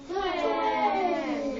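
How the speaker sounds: twin stretched long and slow; turtle slow. Shown as a demonstration. Teacher showing the class the slow turtle way. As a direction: drag every sound of twin out slowly. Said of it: "We say twin slowly like a turtle".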